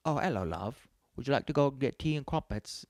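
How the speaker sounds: exaggerated British accent, put on to annoy; mock British accent. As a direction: ham up a silly British accent. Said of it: "The British accent is annoying".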